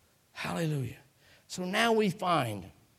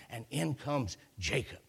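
usual speaking voice

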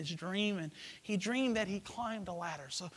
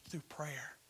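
whispering; 4 semitones lower, 12 dB quieter than usual. Acted. Whispered repetition for emphasis.

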